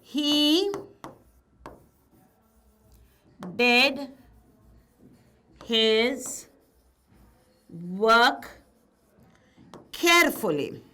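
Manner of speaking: he, did, his, work, carefully: each word alone with long pauses; word by word dictation. Shown as a demonstration. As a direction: dictate it slowly, word by word, for the class to write.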